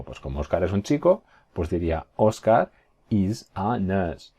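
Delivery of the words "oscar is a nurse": slow, word by word; careful spaced-out English; slow model sentence. Shown as a demonstration. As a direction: separate each word clearly. Teacher models English sentence slowly.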